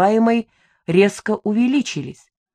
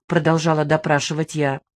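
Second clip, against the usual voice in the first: ordinary talk, nothing marked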